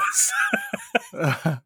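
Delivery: high-pitched burst of real laughter. Real, not acted. Host amused by talk of the videos, laughing.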